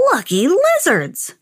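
put-on lightly croaked, drawn-out old man exclamation; croaking old man. A narrator's reading. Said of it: "croaked the old man".

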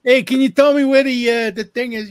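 loud, high-pitched re-enacted holler; calling out. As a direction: poke your head in and holler a question. Re-enacts poking head into room.

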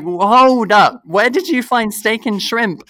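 loud, high disbelief, half laughing; mock outraged disbelief; incredulous hold up. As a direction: call out the outrageous order in shock. Shocked at date's pricey order.

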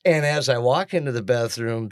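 ordinary talk, nothing marked

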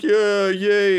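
squeaky mocking yay; mock cheering crowd. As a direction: parody gullible people cheering. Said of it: "people are like".

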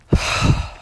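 big audible exhale; heavy sigh. Real, not acted. Hiker spent after her rant, heavy sigh. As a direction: let the anger out in one breath.

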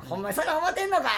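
Osaka auntie voice. Retold or imitated. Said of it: "みたいな感じで".

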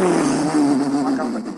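loud, long-drawn-out mocking announcement. Boyfriend announces her to everyone at the house, mock-proclaiming. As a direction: belt it out, stretching the words.

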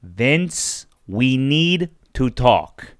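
slow, grave caller; Vince Russo. Acted. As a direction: space the words out with heavy import. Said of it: "Vince Russo".

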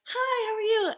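high, chirpy customer-service hello; over-friendly greeting. Retold or imitated. Gym worker greeting members, over-friendly and high. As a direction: sing it out high, mock-friendly. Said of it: "Over friendly".

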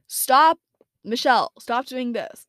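high, loud self-scolding; scolding herself. Real, not acted. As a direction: snap yourself out of a blank, firmly. Scolds herself for going blank.

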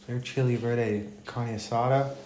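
ordinary talk, nothing marked

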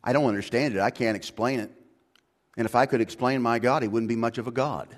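ordinary talk, nothing marked

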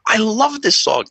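high, slow gushing delight; excited fan. Retold or imitated. Drunk actor gushing over the song, high and excited. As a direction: gush like a starstruck fan. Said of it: "and he goes".

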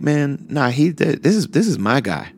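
high, loud, insistent objection; fond protest. Retold or imitated. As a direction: imitate an elder waving off the introduction. Imam playfully rejects the rapper label.

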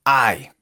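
I pronounced like a burned ay; English I said like ay. Shown as a demonstration. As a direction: say I as a short ay. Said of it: "como si nos pegáramos un quemón con la estufa".

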